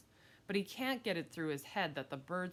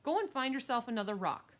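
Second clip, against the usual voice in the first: raised, high-pitched order; annoyed kid shouting; exasperated shout; 7 semitones higher, 5 dB louder than usual. Acted. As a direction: shout her off your turf. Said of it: "I shouted".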